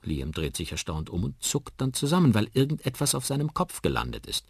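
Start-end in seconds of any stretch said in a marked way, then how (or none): none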